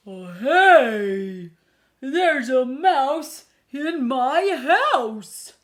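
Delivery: loud, slow, stretched-out alarm; a startled cry of discovery; bear's alarmed discovery. Acted. The bear finds the mouse in his igloo, crying out in alarm.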